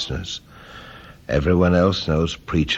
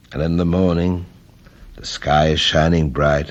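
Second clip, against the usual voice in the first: usual speaking voice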